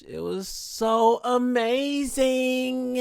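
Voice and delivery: high, slow, drawn-out amazing; gushing. Real, not acted. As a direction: swoon over how great it was. Gushing about yoga class.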